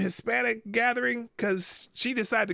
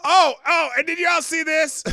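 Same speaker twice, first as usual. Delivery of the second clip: excited; 9 semitones higher, 7 dB louder than usual. Real, not acted. Excited outburst to chat.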